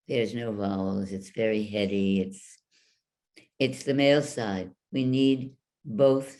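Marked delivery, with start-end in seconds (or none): none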